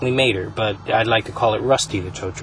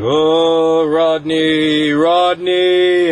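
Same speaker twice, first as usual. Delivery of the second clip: high, loud, sing-song ghostly calling; haunt the kid like a spirit in the storm; spooky ghost voice; 6 semitones higher, 7 dB louder, much slower than usual. Pretend. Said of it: "he starts talking like".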